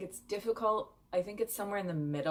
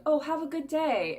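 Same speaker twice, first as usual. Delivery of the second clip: cheery farewell; 10 semitones higher, 7 dB louder than usual. Retold or imitated. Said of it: "what we would say, like".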